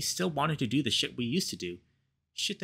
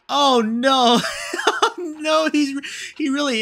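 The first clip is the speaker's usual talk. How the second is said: laughing; 11 dB louder, slower than usual. Real, not acted. Reader breaks off laughing.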